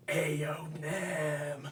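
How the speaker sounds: loud hissed call across the library; whisper shout. Acted. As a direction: shout it under your breath. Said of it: "I'll, I'll, I'll whisper shout".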